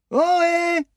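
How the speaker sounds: a long, very high, loud call; shouted hail. A narrator's reading. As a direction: call out across the dark. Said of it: "Maddy continua pourtant de crier". (appel crié, très aigu et fort)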